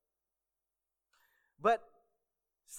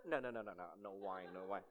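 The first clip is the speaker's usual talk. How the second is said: low, soft mock refusal; understated, low imitation of a refusing guest; imitated diner declining; 7 semitones lower, 7 dB quieter than usual. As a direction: wave the waiter off in a lowered voice. Acts diner refusing waiter's wine.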